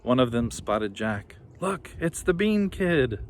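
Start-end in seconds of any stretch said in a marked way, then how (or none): 1.6-3.2 high, slow jeering call; taunting bully. A narrator's reading. Bully spotting Jack, high slow jeer. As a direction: jeer at him.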